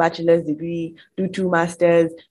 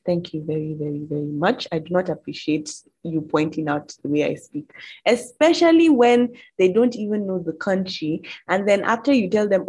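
ordinary talk

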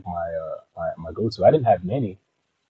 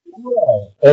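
ordinary delivery

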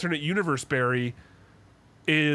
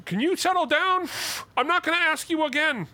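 strained, much higher mock reprimand; mock exasperated scolding; 13 semitones higher than usual. Actor scolding his alternate self, mock exasperated. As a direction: imitate a high-pitched, fed-up telling-off. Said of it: "he's mad that his alternate universe self".

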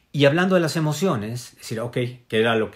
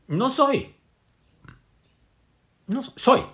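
squeaky high coy answer; playful high reply; 12 semitones higher, slower than usual. Retold or imitated. Answering people who ask what he is, in a coy high voice. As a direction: pitch it way up, teasing, dodging the label. Imagined reply to labelling questions.

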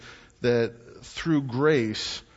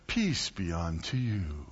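low, soft and slow, sweetly pious; gentle greeting; 8 semitones lower, 6 dB quieter than usual. Retold or imitated. Paul's usual gentle letter opening, soft and low. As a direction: bless them softly and kindly. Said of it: "So nice".

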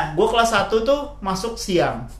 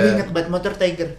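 normal talking voice

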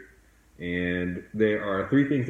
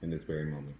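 soft spa whisper; 2 semitones lower, 5 dB quieter than usual. Acted. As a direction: lead them calmly into the past. Leads a guided meditation.

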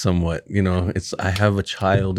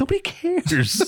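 laughing; slower than usual. Real, not acted. Echoing the joke, laughing.

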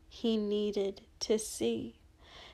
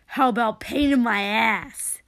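loud, nasty jeer; mocking angry retort; taunting; 7 dB louder than usual. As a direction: hurl it back as a taunt. Villain taunts during fight.

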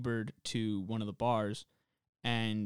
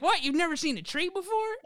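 high, astonished question; mock disbelief. Driver reacts to a man who has never seen trees, with high disbelief. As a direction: act amazed that someone never saw a tree. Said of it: "she's like".

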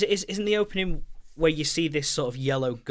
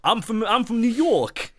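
higher, slow hammed-up New Yorker; New York accent; 6 semitones higher than usual. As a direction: overdo a Brooklyn street accent. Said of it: "someone doing a New York accent".

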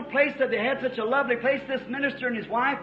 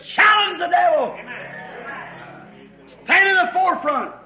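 raised, high battle-cry preaching; shouted preaching charge; 6 semitones higher, 5 dB louder than usual. Retold or imitated. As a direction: drive the command home at full voice. Preacher voices a rousing call.

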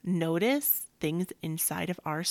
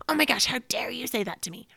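higher, fast, breathy mock outrage; mock furious outburst; 6 semitones higher, 4 dB louder, faster than usual. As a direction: play up the indignant snap. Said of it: "like, furious".

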